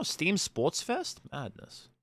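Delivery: ordinary talk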